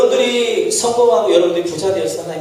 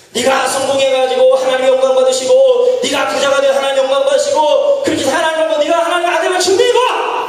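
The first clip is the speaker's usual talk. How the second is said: goading shout; 4 semitones higher than usual. Retold or imitated. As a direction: egg them on with a rising shout. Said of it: "라고 이야기하는 것입니다".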